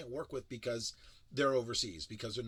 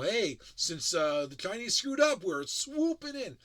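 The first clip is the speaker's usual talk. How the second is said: rising louder, ending high and slow; mock sales pitch; 5 semitones higher, 7 dB louder, slower than usual. Mocking the drone ad, hammed-up gloating pitch. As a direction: gloat like a cheesy marketer swooping in. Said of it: "I don't need to hear about how".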